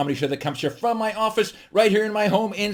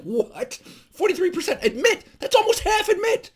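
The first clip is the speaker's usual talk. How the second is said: high-pitched, incredulous outburst; acted shock. Comedian reacting to pilots admitting they sleep, hammed-up shock. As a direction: voice shooting up in hammed-up disbelief. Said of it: "I was shocked".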